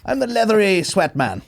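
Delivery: high, laughing storybook character voice; hammed-up, high-pitched character introduction; mock fantasy character. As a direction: announce yourself like a silly film character. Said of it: "like, Harry Potter or something".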